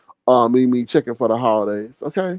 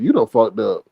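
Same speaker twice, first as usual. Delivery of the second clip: ordinary talk, nothing marked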